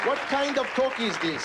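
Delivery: high-pitched scandalized question; mock outrage. Comedian reacting to slang list, mock outraged. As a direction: ask it high, as if appalled.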